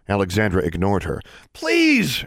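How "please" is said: high, loud cry of appeal; pleading call. A narrator's reading. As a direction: call out desperately to someone unseen. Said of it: "she called out".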